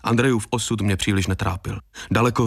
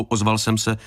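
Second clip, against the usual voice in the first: usual speaking voice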